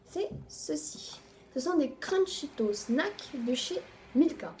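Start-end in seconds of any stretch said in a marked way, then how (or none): 0.1-1.2 whispered reveal. Real, not acted.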